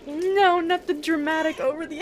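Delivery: loud rising no, no, no; panicked shouting no at the screen; real dismayed cry. Real, not acted. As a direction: protest loudly as the cat falls. Cat companion falls to death.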